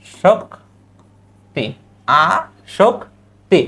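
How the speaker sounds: আসক্তি drawn out very slowly; slow pronunciation demo. Shown as a demonstration. Teacher showing আসক্তি to students, slow. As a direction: stretch আসক্তি syllable by syllable. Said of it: "পরের শব্দটা দেখো".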